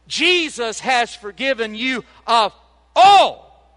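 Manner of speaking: all shouted out high and loud; a real burst of joy peaking on all; preacher erupting with delight; excited yelling. Real, not acted. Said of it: "I'm yelling because I'm excited".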